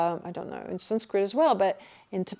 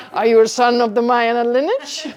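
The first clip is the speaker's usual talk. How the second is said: louder, laughing through the question; amused mock question; 8 dB louder than usual. Teacher mocking stilted Dharma talk, laughing mock question. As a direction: play the jargon for laughs, loudly. Joking imitation of Dharma jargon.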